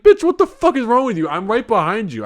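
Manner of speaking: loud, high-pitched indignant rant; mock outraged scolding. Reviewer scolding the girl on TikTok, outraged. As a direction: tell her off, loud and high.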